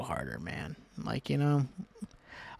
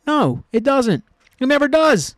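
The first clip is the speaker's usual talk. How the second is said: higher, louder fed-up outburst; exasperated rant; 10 semitones higher, 8 dB louder than usual. Real, not acted. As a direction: blow up, fed up with it all. Ranting at pointless culture fights.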